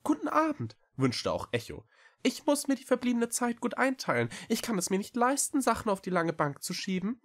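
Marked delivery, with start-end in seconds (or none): 0.0-0.8 high-pitched small cat voice; little cat. A narrator's reading. Cat Echo returns the greeting. 2.2-7.2 very high, quick small-creature voice; little cat Echo, earnest. A narrator's reading. Echo the cat pleads his case.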